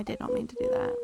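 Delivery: high squeaky protest. Real, not acted. Game mishap, apologizing.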